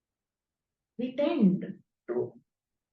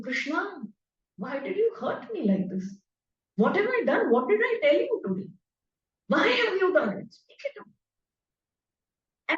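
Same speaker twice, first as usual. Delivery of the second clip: higher, aggrieved devotee crying out to God; pleading with Krishna; 6 semitones higher than usual. Pretend. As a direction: plead with your god as if hurt by him.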